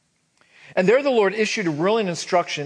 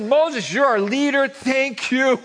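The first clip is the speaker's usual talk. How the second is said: higher, mock-sweet thanks; a hint of fawning Israelites; 9 semitones higher, 5 dB louder than usual. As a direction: gush over the leader, half mocking. Israelites flattering Moses.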